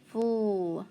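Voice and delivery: "fl" as a drawn-out blend; sounding out. Shown as a demonstration. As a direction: isolate and stretch the opening "fl". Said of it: "and then flying".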